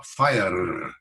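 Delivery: "fire" ending on a rolled R; trilled R. Shown as a demonstration. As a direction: roll the R long at the end of "fire". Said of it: "or it could be a trilled R".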